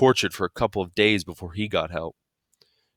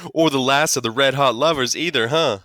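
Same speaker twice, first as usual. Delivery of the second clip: loud, higher mocking jibe; amused teasing; 6 semitones higher, 4 dB louder than usual. A narrator's reading. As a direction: rib him, delighted with your joke. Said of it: "Dad was killing himself".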